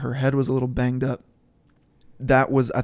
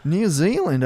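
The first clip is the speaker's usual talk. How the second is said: put-on New Zealand accent; 6 semitones higher, 4 dB louder than usual. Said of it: "in a New Zealand accent".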